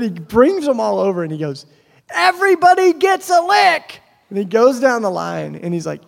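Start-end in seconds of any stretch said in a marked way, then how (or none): 2.1-4.0 put-on high, loud kid's proclamation; imitated child's shrieked announcement; yelling to kids. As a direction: holler it out to the whole crowd. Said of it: "he yells at all the other kids".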